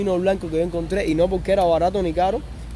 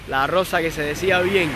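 usual speaking voice

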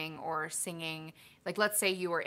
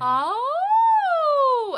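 high, loud sliding oh; high siren oh; 14 dB louder than usual. Shown as a demonstration. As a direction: slide up into head voice. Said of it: "So, like".

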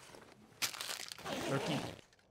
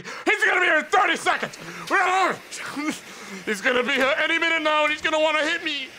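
mock panic; 8 semitones higher, 4 dB louder than usual. Real, not acted. Enemy about to arrive, panic.

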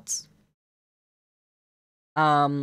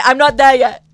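much louder and higher, exasperated; loud snap; 6 semitones higher, 15 dB louder than usual. Real, not acted. Streamer yells at chat nagging about the bow tie, loud and exasperated. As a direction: shout back: I'm not there yet.

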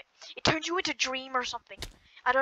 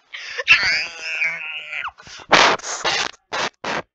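a sudden, loud burst of laughter; 12 semitones lower, 6 dB louder than usual. Real, not acted. Laughs at failed bridge.

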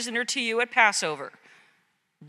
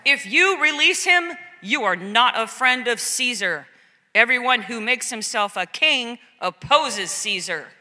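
crowd crying out. Acted. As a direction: warn him with mild outcry.

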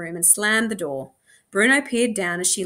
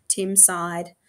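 ordinary delivery, nothing marked

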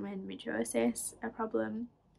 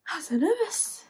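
high, louder mock-nervous squeal; retold nervousness; 8 semitones higher, 6 dB louder than usual. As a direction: act out nerves close to tears, pitched high. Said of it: "nearly started crying".

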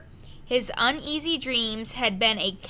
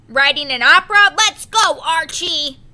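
loud, fast, higher bark through the door; impatient shout; 5 semitones higher, 10 dB louder than usual. A narrator's reading. First mate shouts through the bathroom door, impatient and loud. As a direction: yell to hurry him out.

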